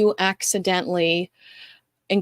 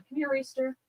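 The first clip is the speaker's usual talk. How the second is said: calling the dog; 6 semitones higher, 9 dB quieter than usual. Real, not acted. Calling her dog over.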